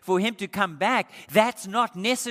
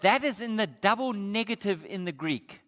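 usual speaking voice

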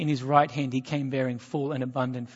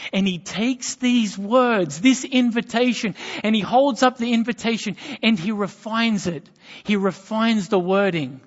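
preaching; 5 semitones higher, 5 dB louder than usual. Acted. Preaching build on Jesus.

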